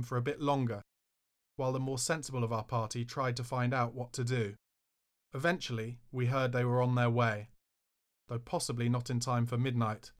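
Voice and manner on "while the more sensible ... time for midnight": ordinary delivery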